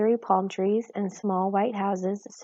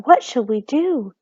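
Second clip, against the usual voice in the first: a worried cry, a little louder; heartfelt cry; 4 semitones higher, 6 dB louder than usual. A narrator's reading. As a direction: cry out in worry. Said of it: "the heartfelt cry of".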